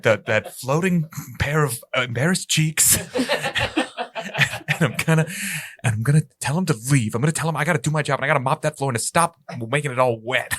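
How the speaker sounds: gruff janitor. Acted. Plays the janitor.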